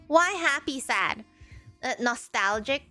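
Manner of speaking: ordinary delivery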